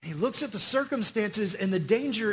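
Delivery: usual speaking voice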